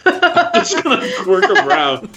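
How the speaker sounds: high, squeaky with laughter; laughing. Real, not acted. Player describing a reaction, laughing. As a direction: let giggles push the voice right up.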